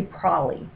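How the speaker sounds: "prolly" said as PRAH-lee; casual prolly. Shown as a demonstration. Chatting with each other, "prolly" as PRAH-lee. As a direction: shrink probably to two syllables, "prolly". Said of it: "if we're talking to each other".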